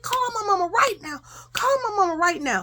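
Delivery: high, tearful demand, reenacted; retold hollering. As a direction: wail it like a desperate child. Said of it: "I'm hollering and screaming".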